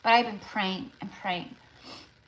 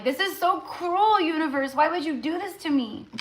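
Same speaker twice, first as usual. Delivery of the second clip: anguished plea; 8 semitones higher than usual. Retold or imitated. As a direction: plead with the universe, heartbroken. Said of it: "I'm like".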